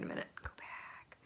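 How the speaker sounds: breathy whispered aside. Real, not acted. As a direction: whisper it to yourself.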